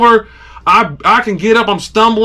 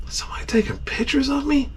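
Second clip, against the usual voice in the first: hushed, incredulous whispered question; suspicious whisper; 5 semitones higher, 11 dB quieter than usual. Retold or imitated. Dozing angler hearing camera clicks, suspicious whisper. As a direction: whisper it under your breath, higher at the end.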